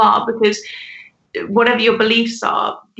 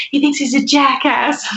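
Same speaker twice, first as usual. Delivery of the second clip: Southern US accent put on for a quote; imitated Southern accent; 4 semitones higher than usual. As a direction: mimic a Southern friend's accent. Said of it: "her accent is so beautiful".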